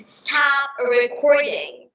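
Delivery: slow, smooth American accent. Shown as a demonstration. Showing partner the smoother American version, American accent. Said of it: "But in America, we say".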